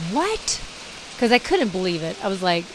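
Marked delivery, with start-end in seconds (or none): 0.1-0.6 stunned high yelp held back in volume; retold shocked scream. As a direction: mock-scream it in shock, pitched way up. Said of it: "I screamed".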